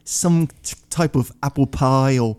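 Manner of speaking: slightly halting mock British accent; name the flavors in a put-on British accent. Shown as a demonstration. Showing publications how to sound smarter, British accent. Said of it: "Do it in a British accent".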